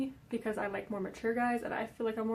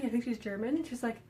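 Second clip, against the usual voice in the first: normal talking voice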